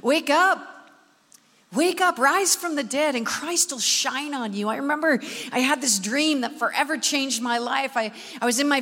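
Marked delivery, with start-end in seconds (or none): 0.0-4.7 rousing scripture call; scripture call to wake up; preaching call. Acted. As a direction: call the church awake. Opens sermon with scripture call.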